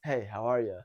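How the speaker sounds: low, deep adult greeting; calm, relaxed. Shown as a demonstration. Coach shows the right hello to an adult, calm and relaxed. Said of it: "More calm, more relaxed".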